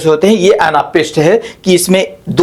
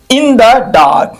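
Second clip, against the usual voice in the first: "In the" light, "dark" drawn out as the beat; two weak syllables "In the", then stressed "dark"; anapest stress; 4 semitones higher, much slower than usual. Shown as a demonstration. Teacher showing an anapest foot, stress on "dark". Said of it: "दो वीक आते हैं अर्थात unstressed आते हैं".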